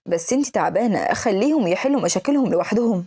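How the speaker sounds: ordinary delivery, nothing marked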